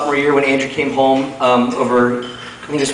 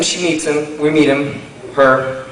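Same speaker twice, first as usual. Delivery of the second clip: usual speaking voice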